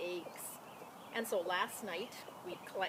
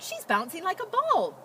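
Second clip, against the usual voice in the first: high, louder surprised cry; 10 semitones higher, 8 dB louder than usual. A narrator's reading. Farmer's wife spotting Emma at the window, surprised high cry. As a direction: exclaim in surprise at the hen. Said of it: "farmer's wife cried".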